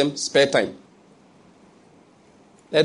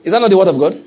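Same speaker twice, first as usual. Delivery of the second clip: loud laughing rhetorical question; 4 semitones higher, 6 dB louder, faster than usual. Real, not acted. Preacher to congregation, laughing and loud. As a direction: laugh while calling it out.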